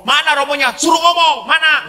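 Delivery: high, insistent mock demand; imitated irate wife. Wife demanding to hear the priest on the phone, high mock-angry voice. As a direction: fire it off as a bossy, suspicious wife.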